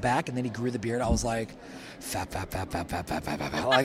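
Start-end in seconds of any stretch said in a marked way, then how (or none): 2.0-3.7 low, quiet, rapid-fire repeated syllable; imitated fapping noise. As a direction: mimic a repetitive slapping noise under the voice. Imitated repeated noise after crush talk.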